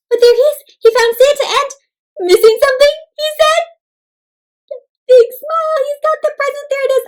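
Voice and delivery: excited reveal. Real, not acted. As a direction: burst out with delight.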